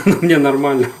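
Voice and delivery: chuckling through the words; laughing it off. Real, not acted. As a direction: laugh it off after a jolt. Laughs off an electric shock. (со смешком)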